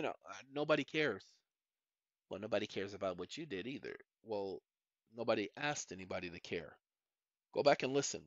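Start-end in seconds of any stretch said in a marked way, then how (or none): none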